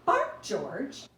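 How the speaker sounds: loud calling out to George; mother's command. Acted. Mother calling her puppy, loud command. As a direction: call him firmly like a mother. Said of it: "George's mother said".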